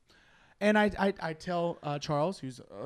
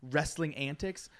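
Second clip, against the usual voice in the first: usual speaking voice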